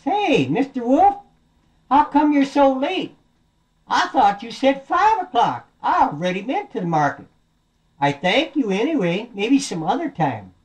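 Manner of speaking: high-pitched, taunting piglet; cheeky little piggy. Acted. Piggy teasing the wolf for being late, high and cheeky. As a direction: crow it, easing off toward the end.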